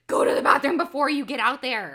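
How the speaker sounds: re-enacted frantic parental order; freaked-out mom. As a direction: holler it at a kid across a field. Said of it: "it just freaks me out".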